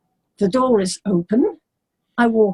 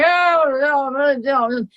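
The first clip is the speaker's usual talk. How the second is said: high, mocking oh oh; jeering like a hostile crowd; mock English heckling; 5 semitones higher, slower than usual. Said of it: "the English were saying".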